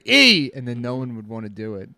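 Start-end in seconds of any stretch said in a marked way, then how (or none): 0.0-0.5 high, loud chanted letter; pirate crewman's shout. Acted. Another pirate continuing the morale chant, shouted. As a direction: yell it out to the crew. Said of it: "the guy would say".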